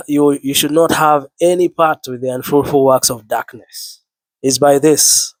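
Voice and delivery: ordinary delivery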